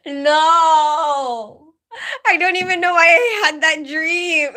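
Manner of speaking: half-laugh, half-wail in embarrassment. Real, not acted. Laughing at her own weird dream.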